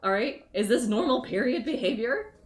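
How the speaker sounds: loud, high exasperated rant. Real, not acted. Venting at dismissive doctors, loud exasperated rant. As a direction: vent at the doctors who brush her off.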